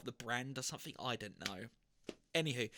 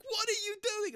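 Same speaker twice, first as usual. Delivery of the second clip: much higher, louder, incredulous what are you doing; startled outburst; 4 dB louder than usual. Retold or imitated. Manager walks in on the boiler cleaning, shocked high outburst.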